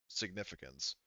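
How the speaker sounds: ordinary talk, nothing marked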